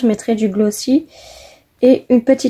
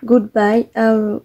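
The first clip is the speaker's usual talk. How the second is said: English words with a real French accent; slower than usual. Real, not acted. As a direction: read the English words one by one in your own French accent. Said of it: "mon anglais, hein, mon accent". (anglais avec un accent français)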